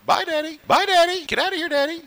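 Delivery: put-on very high, sing-song child's goodbye; cheerful little daughter. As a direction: chirp it like a happy little girl. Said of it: "She's always happy when I go".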